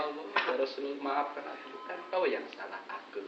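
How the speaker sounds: soft, pleading apology; soft tearful apology. Retold or imitated. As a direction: murmur sorry as if near tears.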